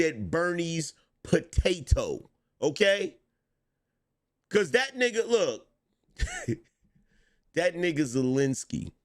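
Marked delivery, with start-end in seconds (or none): none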